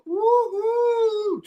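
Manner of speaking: high joyful whoop; excited whoop. Real, not acted. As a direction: whoop it out loud. Thanks to supporter.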